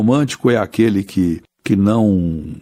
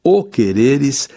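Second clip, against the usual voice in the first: slow poem recitation; 4 semitones higher, slower than usual. Shown as a demonstration. Teacher recites closing verse slowly.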